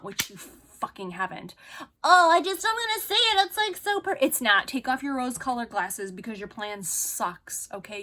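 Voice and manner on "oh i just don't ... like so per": high, loud whiny mock voice; sneering imitation of the friend. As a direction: parody her, high and loud. Mocking friend's excuse.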